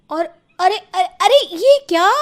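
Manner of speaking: high, loud startled cry of alarm; alarmed shout. Acted. Narrator crying out as tea lands on the guests, loud alarm. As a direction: yell out as the tea spills.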